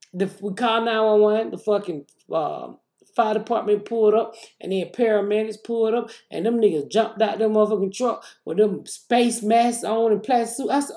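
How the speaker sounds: ordinary talk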